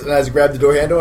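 ordinary delivery

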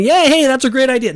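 high-pitched pretend cheer of approval; mock enthusiasm. Host voices what God is not saying, mock enthusiastic approval. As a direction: pitch it way up, hammed-up delight.

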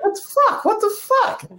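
very high squeaky disbelief; falsetto-like baffled question; mock bafflement. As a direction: imitate a bewildered crowd way up high. Acts out baffled audience during intro.

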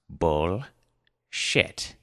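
each syllable set apart by a pause; grave precision. A narrator's reading. Grandfather to his giggling granddaughters, with grave precision. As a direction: pronounce it gravely, piece by piece. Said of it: "grave precision pronounced".

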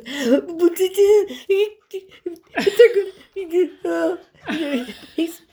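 high, loud mock bawling; hammed-up wailing, broken by sobs; hysterical sobbing. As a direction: choke out the plea in drawn-out mock sobs. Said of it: "she's hysterical on the other end of the line".

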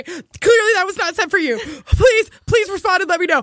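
high frantic begging; panicked pleading. Retold or imitated. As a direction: rush the apology in a panic. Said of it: "panicked retold texts, high pitch".

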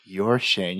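hushed, ominous mock reveal; deadpan reveal. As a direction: drop down low and quiet for the twist. Acted prankster's reply.

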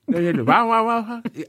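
high, mush-mouthed mimicry with slurred words. Host showing how the rapper talks with veneers, mush-mouthed impression. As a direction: imitate a rapper talking through veneers, consonants lost. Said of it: "He talked like this".

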